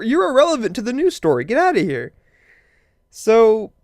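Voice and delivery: usual speaking voice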